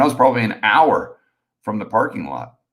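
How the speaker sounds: ordinary delivery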